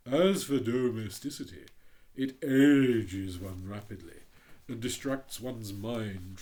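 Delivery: hint of a haughty rocket; lightly voiced pompous Rocket. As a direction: read it as a vain firework showing off. The Rocket, slowing.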